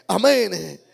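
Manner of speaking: ordinary delivery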